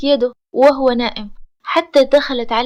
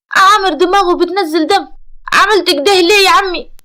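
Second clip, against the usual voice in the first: higher, louder acted tearful plea; tearful and anxious; 6 semitones higher, 5 dB louder than usual. A narrator's reading. Nourseen asking her uncle about bleeding Amer, tearfully anxious. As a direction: plead anxiously, as if close to tears. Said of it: "فتحدثت ببكاء ولهفة".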